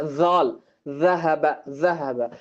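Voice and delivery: ஜால் and ذهب opened with a voiced th; tongue below the upper teeth for the first sound of ஜால் and ذهب; tongue under teeth. Shown as a demonstration. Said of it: "அந்த பல்லுக்கு கீழே இருந்து".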